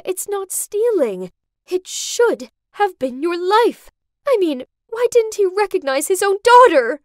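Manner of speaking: high plea building to a loud outburst; upset protest. A narrator's reading. Mom defends her plan to daughter, upset protest.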